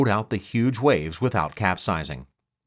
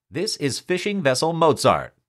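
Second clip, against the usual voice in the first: higher, urgent radio call; mayday call; 8 semitones higher than usual. Acted. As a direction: announce the boat over the radio. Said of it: "he called a Mayday alert on the radio".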